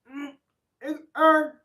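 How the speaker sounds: hammed-up bark of an honest bigot; mock outburst, loud and high; loud mock grunt. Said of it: "I'd rather you just be racist".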